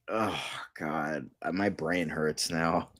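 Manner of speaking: exhausted despair; weary groan. Real, not acted. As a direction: sound drained and pained. Despair at casting news.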